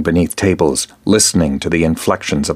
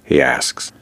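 usual speaking voice

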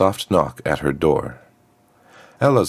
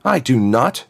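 higher-pitched indignant denial; cried protest; 9 semitones higher, 3 dB louder than usual. A narrator's reading. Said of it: "cried Azriakam".

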